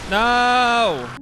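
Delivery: sudden high, loud cry of "No!"; a real gamer's scream of defeat; frustrated scream. Real, not acted. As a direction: yell it at the screen in real frustration. Streamer loses a game attempt.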